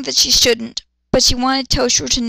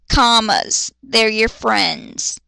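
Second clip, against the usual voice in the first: slow lecturing; 2 semitones lower, much slower than usual. Real, not acted. Mock lecture on commas.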